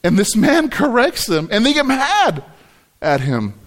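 tickled, voice climbing with laughter; amused, laughing. Real, not acted. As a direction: laugh through the words, rising high at the end. Amused telling of a funny passage.

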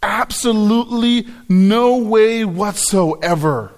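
slow, weighty stress on every word of "absolutely no way whatsoever"; most emphatic. Shown as a demonstration. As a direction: stretch out "absolutely", punch "no way whatsoever". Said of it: "the most emphatic way of saying".

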